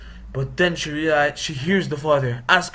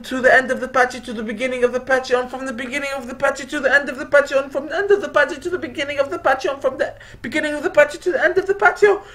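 fast repeated patter; 11 semitones higher, much faster than usual. A narrator's reading. Sliding snakes back and forth.

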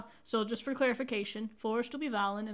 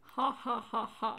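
slow, put-on ha ha ha; deliberate, hammed-up ha ha laugh; acted laughter; 4 dB quieter, slower than usual. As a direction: spell out a slow fake laugh. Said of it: "snort and bust out laughing".

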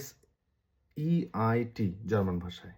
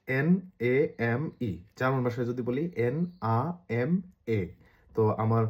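normal talking voice

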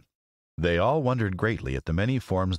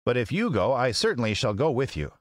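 high-pitched character voice; Tin Woodman; 5 semitones higher than usual. Acted. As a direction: speak high as a tender-hearted tin man. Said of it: "remarked the Tin Woodman".

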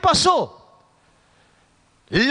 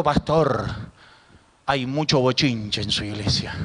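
much lower voice of a complaining churchgoer; feligrés quejoso imitado; 10 semitones lower, 4 dB quieter than usual. Retold or imitated. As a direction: imitate a complaint to the pastor, pitched low. Acting a complaining church member. (feligrés que se queja, en voz grave)

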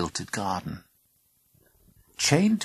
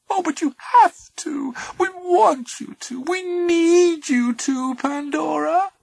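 coaxing creature voice; 7 dB louder, slower than usual. Acted.

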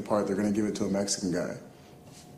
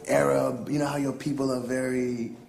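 higher, insinuating director voice; imitated director; 4 semitones higher than usual. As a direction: mimic a director hinting at what he wants. Director hinting, voiced in story.

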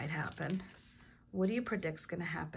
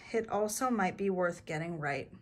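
plain reading voice

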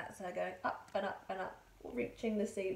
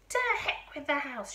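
put-on loud, raised old-lady dismissal; defiant shout; 5 semitones higher, 8 dB louder than usual. A narrator's reading. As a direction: shout the objection away. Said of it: "she shouted".

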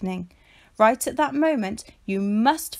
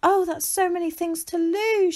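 tearful; 8 semitones higher than usual. A narrator's reading. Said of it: "Shelley cried".

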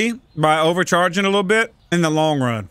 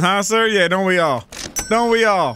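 ordinary talk, nothing marked

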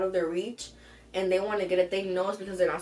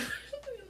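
laugh-sob; 11 dB quieter than usual. Real, not acted. Laugh breaking into cry.

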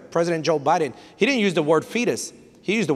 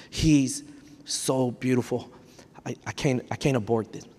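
softer, lower, tender murmur; tender hush; 5 semitones lower, 6 dB quieter than usual. Retold or imitated. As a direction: speak softly over the newborn. Jochebed seeing baby Moses.

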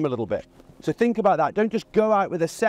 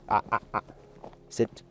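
low warning; 9 semitones lower, 7 dB quieter than usual. Real, not acted. Warning the dog mid-walk.

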